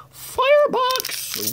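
very high, slow, stretched-out cry of firebox; high squeal. Acted. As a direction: belt it out as a high falsetto yell. Echo shouted in a high voice.

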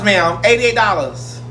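ordinary talk

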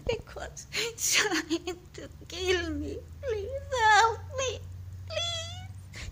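high, crying plea for help; terrified pleading. Acted. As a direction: beg through sobs. Victim begging for help.

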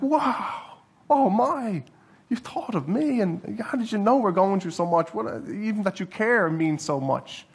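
high, amazed and touched; high, overwhelmed thank-you; grateful delight. Pretend. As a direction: gush with surprised gratitude. Grateful recipient voiced in sermon.

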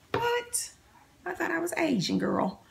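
stunned squeal at the result; shocked disbelief. Real, not acted. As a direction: jump up in pitch in disbelief.

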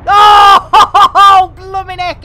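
loud, high startled laugh; surprised shout. Real, not acted. Watching his wingman's stunt, surprised laughing shout. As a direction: burst out laughing in shock.

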